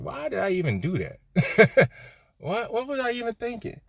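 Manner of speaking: high-pitched mock disbelief; baffled self-questioning. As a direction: question yourself in a high, incredulous voice. Acts out puzzled self-questioning after wrongdoing.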